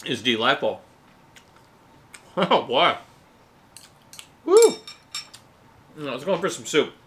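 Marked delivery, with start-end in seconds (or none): 4.5-4.8 high loud whoop of delight; excited whoop. Real, not acted. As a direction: whoop with joy. Excited whoop after tasting.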